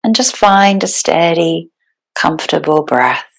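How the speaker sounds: calm, unhurried meditation guide with long pauses; guided meditation. Real, not acted. Yoga teacher settling the class into breathwork, guided meditation. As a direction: ease them into stillness, phrase by phrase.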